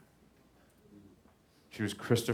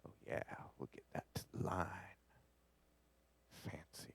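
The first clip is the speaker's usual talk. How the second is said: whisper; 11 dB quieter than usual. Real, not acted. Whispering while editing.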